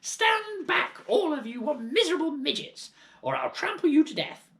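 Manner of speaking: put-on wild old woman whooping; grandma shouting. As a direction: bellow at everyone from the galloping pony.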